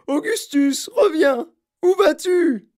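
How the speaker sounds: très aigu et fort, qui monte; appel affolé. Acted. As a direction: appeler son fils qui s'en va. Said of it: "hurla madame Gloop". (la mère appelle son fils, très aigu et fort)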